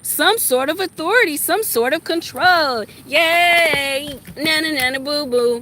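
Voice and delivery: mock her like a bratty kid; imitating a bratty child taunting nana nana boo boo; childish mock voice. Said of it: "like a little kid saying".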